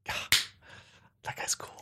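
softly imitated admiration; hushed, acted awe; awed whisper. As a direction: whisper it like a starstruck fan. Acted awed movie watcher.

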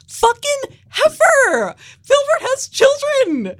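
high, loud, slow furious yell; mock outrage. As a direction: yell it in comic fury. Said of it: "I literally was like".

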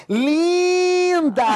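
high, drawn-out fake compliment; mock gushing. Imitating a commenter under someone's photo, mock gushing. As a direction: gush it with exaggerated sarcasm. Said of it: "eu acho um deboche enorme quando a pessoa bota linda".

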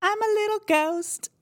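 very high little ghost squeak; small, high-pitched ghostly peep; tiny ghost voice. Acted. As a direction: pipe it up in a teeny spirit voice. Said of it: "a real tiny little voice".

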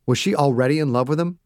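plain reading voice

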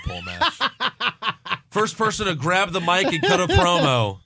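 laughing through the words; chuckling while describing it. Real, not acted. Laughing at own joke.

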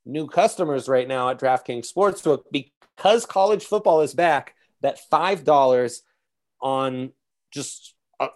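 excited sponsor read, pushing the offer; hyped ad read. Acted. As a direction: sell the deal with big energy.

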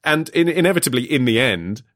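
normal talking voice

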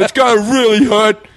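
put-on high drunken pronouncement; drunk self. Drunk puncher watching his hand swell, high drunken voice. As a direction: announce it like a merry drunk. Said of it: "I was so drunk that it was like".

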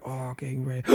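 softly acted-out wind-up; acted hushed preparation. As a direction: act it small and hushed, winding up for a slap. Acting out girlfriend sneaking up.

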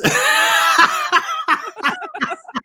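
big real belly laugh. Real, not acted. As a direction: burst out laughing. Caller's wheelchair joke.

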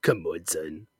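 low, soft deadpan aside; deadpan disbelief. Acted. Podcaster reacting to a wrestler's new gimmick, low deadpan. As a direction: mutter it very low and quietly.

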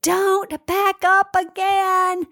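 drawn-out high shriek of alarm; retold scream. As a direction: mimic a stranger shrieking a warning. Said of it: "I hear this woman scream".

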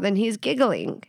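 usual speaking voice

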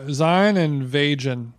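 slow "zine and vagin", as "zyne and VAY-jun"; drawn-out wrong take on "zine and vagin"; mispronouncing. Retold or imitated. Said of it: "people couldn't pronounce zine or vegan".

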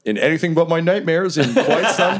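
big, lilting announcer delivery; Remy. Acted. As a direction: address the crowd as the fight's emcee. Remy says, standing up.

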